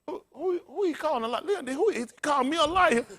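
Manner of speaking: high, hushed, laughing indignation; mock indignation. Mocking offended listener.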